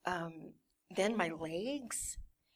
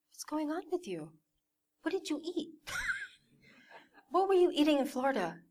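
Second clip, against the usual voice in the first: mom's questioning; 7 semitones higher than usual. Retold or imitated. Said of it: "She's like".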